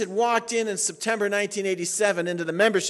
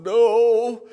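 louder, weepy clipped answer; mock tearful no; 5 semitones higher, 9 dB louder than usual. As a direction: answer through fake tears. Weeper's sniffling answers.

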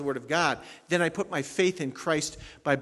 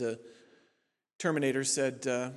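ordinary talk, nothing marked

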